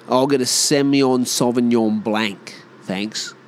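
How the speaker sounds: broad Aussie "Blank" for Blanc; Aussie mangling of French wine names; Aussie ordering wine. Acted. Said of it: "you heard yourself when we order wine".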